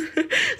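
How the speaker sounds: a short high giggle. Real, not acted. Laughs at her joke.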